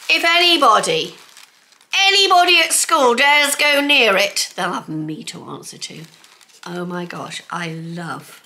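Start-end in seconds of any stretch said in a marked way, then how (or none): 0.1-6.0 loud, higher hammed-up warning; mock threat. Warning anyone at school off her cup, loud mock threat. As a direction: boom it out like a playful threat.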